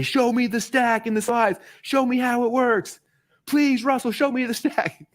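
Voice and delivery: high-pitched, drawn-out begging; mock audience plea. Audience begging coach to show the stack, mock pleading. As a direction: plead slowly like an eager crowd.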